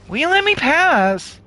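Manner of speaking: high-pitched put-on questioner; squeaky-high acted plea; player character asking. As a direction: pitch it way up, a polite request. Voices player's line to guard.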